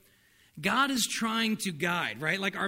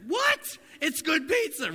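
mock outrage; 10 semitones higher, slower than usual. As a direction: act shocked at someone who never ordered.

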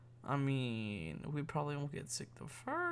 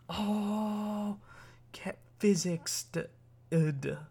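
exasperated; 6 semitones higher, 6 dB louder, much slower than usual. Retold or imitated. Groaning about physics class.

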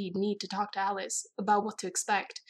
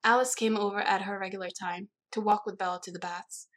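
plain reading voice

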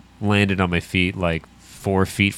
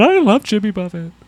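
imitating a yawning voice; 10 semitones higher, 4 dB louder than usual. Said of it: "It sounded like someone else".

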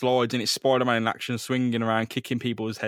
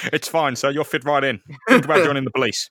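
mock cop; 2 semitones higher, 7 dB louder than usual. Cop voice mocking Peter's outfit.

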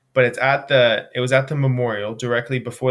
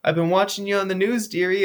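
higher, lightly put-on great-grandmother voice; a kindly great-grandmother praising the young runner, higher; sweet old lady; 7 semitones higher than usual. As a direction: play a doting great-grandmother, pitched up. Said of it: "probably a great-grandmother".

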